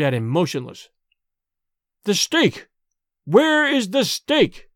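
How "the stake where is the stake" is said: high, slow, drawn-out demand; desperate cry. A narrator's reading. Son desperate for the stake before his father, crying out. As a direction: cry out and stretch the question. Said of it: "cried Dorda".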